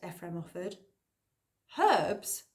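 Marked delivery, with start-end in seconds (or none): 1.8-2.4 higher, louder disbelieving echo; scornful disbelief. A narrator's reading. Girl scoffing at Ephraim's herb suggestion, disbelieving. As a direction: spit it back as if absurd.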